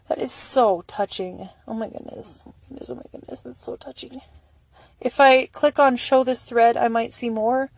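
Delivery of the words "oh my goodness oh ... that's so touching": hushed, breathless whisper of being deeply touched; almost no voice, just moved breath. Real, not acted. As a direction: whisper it, overcome by the kindness. Moved by charity posts.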